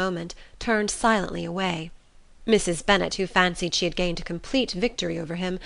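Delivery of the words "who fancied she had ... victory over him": ordinary delivery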